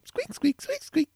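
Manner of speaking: high squeaky chair noise; mimicking the chair squeaking side to side; squeaky chair imitation. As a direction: squeak like a creaky office chair.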